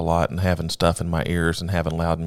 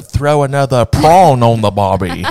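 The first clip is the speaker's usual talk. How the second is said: put-on loud, raised Australian accent; mock Australian accent; 4 semitones higher, 8 dB louder than usual. Offering Australians a joke apology, mock Australian accent. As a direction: push a comic Australian accent louder. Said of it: "I should have said".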